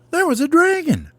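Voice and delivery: put-on very high, childlike announcement; high storybook chick voice; baby bird voice. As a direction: squeak it out as an excited little bird. Child bird tells daddy its dream.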